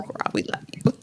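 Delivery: soft whispered thanks to a fan. Real, not acted. Host to a live chat viewer, whispered affection. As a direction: breathe it out as a whisper.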